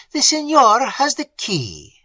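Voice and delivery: high, slow, loud lady's voice; resigned aunt. A narrator's reading. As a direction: spell out the hopelessness. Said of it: "said Madame Montoni".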